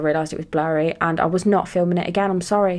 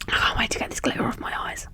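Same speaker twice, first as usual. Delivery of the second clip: whisper; 6 dB quieter, faster than usual. Real, not acted. Muttering about glitter.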